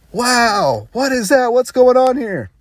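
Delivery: high-pitched imitated amazement; mimicking someone astonished by a strange sight; amazed reaction. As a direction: play wide-eyed wonder, pitched well up. Acting Aloy's amazed reaction to birth.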